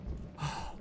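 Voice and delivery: soft, voiceless sigh; disappointed sigh. Acted. Streamer let down by chat, disappointed sigh. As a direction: let out a quiet, let-down breath. Sighs after reproaching chat.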